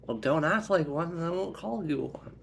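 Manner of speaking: high, loud comeback; defiant retort. Acted. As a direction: argue back at your sister. Sister argues back.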